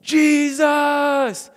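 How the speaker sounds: high, loud shout; crowd's rallying shout; shouted answer. Retold or imitated. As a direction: yell the name back in unison. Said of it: "we all yelled".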